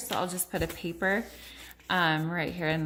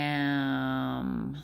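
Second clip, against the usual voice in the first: RAM stretched, every sound held and blended; slow sounding-out; 5 semitones lower than usual. Shown as a demonstration. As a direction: draw RAM out like "rrraaammm". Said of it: "say it slow".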